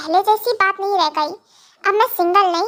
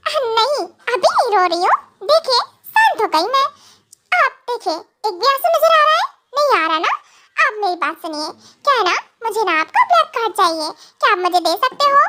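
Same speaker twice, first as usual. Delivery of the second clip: higher, sweet-talking wife asking a favour; coaxing young wife; 4 semitones higher than usual. Acted. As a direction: wheedle the husband, half laughing. (ऊँची, मनाती हुई पत्नी)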